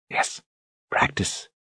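soft breathy murmur. A narrator's reading. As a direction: breathe the words out. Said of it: "she said in a breathy voice".

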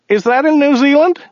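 very high-pitched driver voice; driver character. A narrator's reading. Driver confusing countries with his passenger, very high character voice.

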